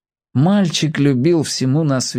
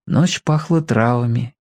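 ordinary delivery, nothing marked

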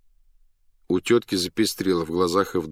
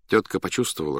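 ordinary delivery, nothing marked

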